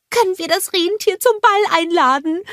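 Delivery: high-voiced eager snowman question; Olaf. A narrator's reading. Snowman asking to invite the reindeer, high eager voice. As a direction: ask in a very high character voice. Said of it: "fragte Olaf".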